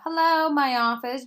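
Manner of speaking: loud, high, drawn-out jokey hello; joking. A narrator's reading. Doctor phones her own office, joking loudly. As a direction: joke it, slow and bright on top. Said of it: "joked Doctor Horton".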